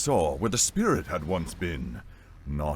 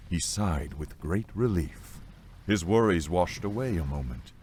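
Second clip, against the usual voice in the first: ordinary delivery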